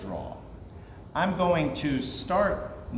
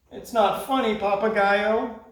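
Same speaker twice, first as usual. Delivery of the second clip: pouting; 7 semitones higher than usual. Acted. Creatures pouting at the laughing parrot, high whine. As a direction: complain like sulking children. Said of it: "they said, pouting".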